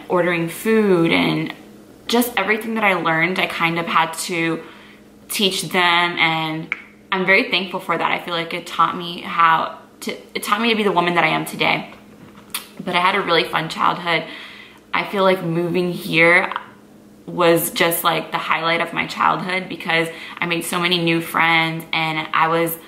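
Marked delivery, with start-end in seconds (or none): none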